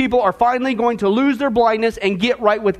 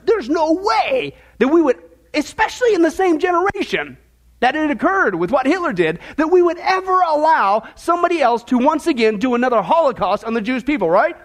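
higher-pitched mock disbelief; sarcastic "no way", pitched up; 4 semitones higher than usual. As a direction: protest with sarcastic, exaggerated incredulity. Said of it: "I'm using sarcasm".

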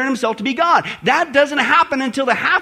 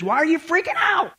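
exasperated mock shout; 7 semitones higher than usual. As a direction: scold them for panicking, higher. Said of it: "the Crone translation".

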